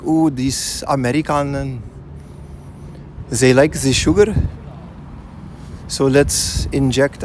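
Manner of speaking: put-on a light, slowed stab at a German accent; half-hearted German accent, barely shifted; mock German accent. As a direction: lightly imitate a German marveling at Americans, drawn out. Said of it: "I'm trying to do a German accent".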